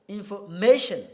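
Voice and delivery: usual speaking voice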